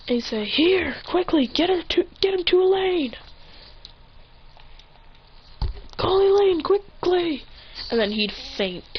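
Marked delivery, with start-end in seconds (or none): 0.4-7.4 high, pressing, acted alarm; pitched-up frantic instructions; urgent plea. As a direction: beg for help before you collapse.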